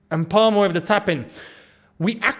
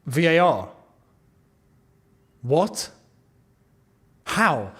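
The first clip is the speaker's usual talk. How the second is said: ordinary talk, nothing marked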